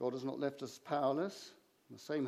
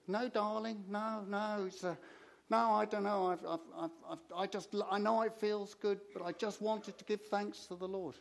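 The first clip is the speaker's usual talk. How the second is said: higher-pitched, lightly voiced Cockney lady; a hint of a humble Cockney churchgoer, pitched up; little Cockney lady; 7 semitones higher than usual. Cockney lady answers Jane after the service, lightly voiced, higher. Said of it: "little Cockney lady".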